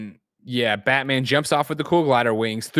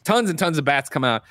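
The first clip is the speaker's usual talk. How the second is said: ordinary talk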